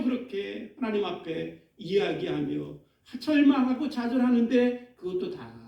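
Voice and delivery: ordinary talk, nothing marked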